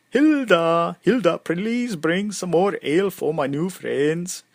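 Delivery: put-on loud, high Swedish-accented call; yelling out. Bald man yelling to a barmaid for ale, Swedish accent. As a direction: holler across a noisy hall in a Swedish accent. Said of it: "he yells out".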